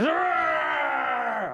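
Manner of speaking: high, growled dragon roar; imitated dragon roar. The dragon roars at Artie, acted creature roar. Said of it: "and the dragon goes".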